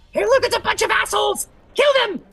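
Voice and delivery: very high shouted charge; squeaky kobold war cry. Acted. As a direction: yell a battle cry as tiny kobolds. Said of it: "and they go".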